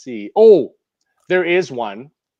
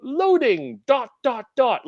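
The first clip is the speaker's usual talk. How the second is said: high-pitched announcer imitation, each word proclaimed; wrestling announcer; 10 semitones higher, slower than usual. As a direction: proclaim it like a goofy ring announcer. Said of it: "like, a wrestling voice".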